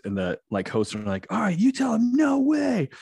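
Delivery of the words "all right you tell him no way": very high, urging cry; pitched way up, cheering the child on; hyped-up show host. Retold or imitated. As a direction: egg a kid on in a high, excited voice. Voicing hosts egging the girl on.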